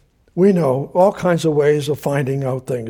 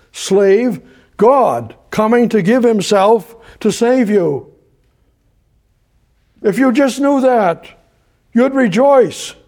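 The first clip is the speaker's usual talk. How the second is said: fervent preaching; 4 semitones higher than usual. Acted. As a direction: plead with the congregation, building.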